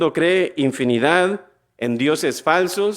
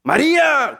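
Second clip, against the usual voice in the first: raised, ringing cry of the crowd; crowd's shout; 9 semitones higher, much slower than usual. Retold or imitated. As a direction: proclaim it like an angry mob. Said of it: "gritaron diciendo".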